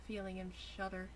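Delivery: ordinary talk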